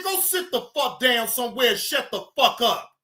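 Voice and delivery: ordinary talk, nothing marked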